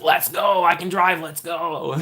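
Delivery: high, eager pleading "Let's go"; eager begging. Pretend. Coaching a fan to pester parents, high eager begging. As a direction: beg like an excited kid who wants to leave now. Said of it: "Be like".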